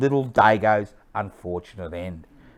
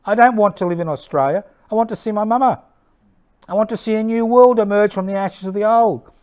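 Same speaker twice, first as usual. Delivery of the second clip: high, rushed, desperate plea; frightened pleading; 9 semitones higher, faster than usual. Acted. Internee begging to be let out and go home, frightened pleading. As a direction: plead in a raised, urgent voice.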